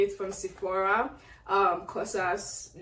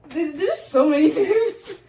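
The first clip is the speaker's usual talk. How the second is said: high gasp, then louder, laughing near tears; gasping excitement; 11 semitones higher, 7 dB louder than usual. Real, not acted. Overwhelmed opening more gifts.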